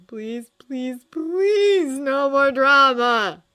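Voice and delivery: rising to a loud, slow, high wail; desperate prayer. A narrator's reading.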